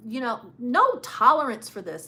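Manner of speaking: ordinary talk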